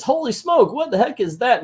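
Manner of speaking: high-pitched cartoon astonishment; mock startled villain.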